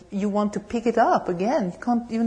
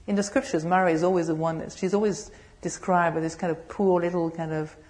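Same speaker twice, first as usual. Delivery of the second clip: normal talking voice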